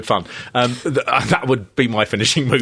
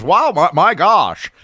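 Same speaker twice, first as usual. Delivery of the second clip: slow, higher, louder amazed gasp; Eugene Levy impression; 5 semitones higher, 4 dB louder, slower than usual. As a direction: marvel slowly like an awestruck travel host. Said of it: "where he walks around and goes".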